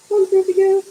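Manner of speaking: put-on high baby-talk voice for a pet; coaxing pet voice. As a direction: lure the cat over with treats, sweetly. Calling the cat by nickname.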